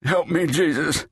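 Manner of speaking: weeping cry. A narrator's reading. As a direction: cry out to Jesus through tears. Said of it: "The old man wept".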